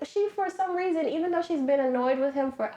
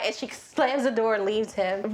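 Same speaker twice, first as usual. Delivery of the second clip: ordinary delivery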